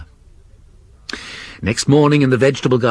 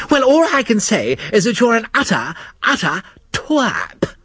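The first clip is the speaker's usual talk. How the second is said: angry; 13 semitones higher than usual. Acted. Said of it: "It was E W who was shouting angrily".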